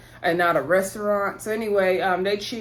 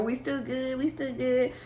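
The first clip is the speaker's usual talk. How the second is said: softer, slightly higher quoted voice; soft, raised mock reassurance; retold placating; 4 semitones higher, 5 dB quieter than usual. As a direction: lightly imitate someone smoothing it over. Retells his placating handshake words.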